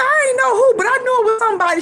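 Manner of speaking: high-pitched retold mama; imitating mama. Mama recalling a birthday on the phone, high-pitched voice.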